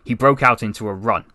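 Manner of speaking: ordinary delivery, nothing marked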